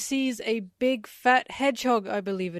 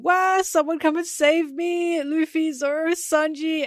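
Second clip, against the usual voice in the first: high, loud cry for rescue; wailing yell; 7 semitones higher, 7 dB louder than usual. Acted. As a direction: wail it out, calling each name for help. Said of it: "she's yelling".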